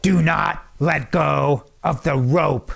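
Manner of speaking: slow, emphatic mock drill sergeant; drill sergeant's order. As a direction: lay down the rule like a sergeant, word by word. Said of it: "His second instruction was".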